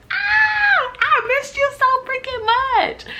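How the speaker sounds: very high, drawn-out happy shout; excited squeal. Real, not acted. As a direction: squeal it loud, stretching the words. Gushing greeting to viewers.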